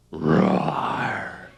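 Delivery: a deep roar, shouted out; lion roar. Pretend. Teacher roaring like a lion for the children. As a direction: roar like a big lion for the kids. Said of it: "And the lion goes".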